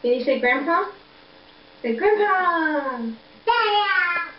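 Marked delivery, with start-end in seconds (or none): 1.8-4.3 high, long-drawn singsong; playful coaxing. Pretend. Coaxing baby to say Grandpa, playful singsong. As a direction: stretch the name out high for a baby to copy.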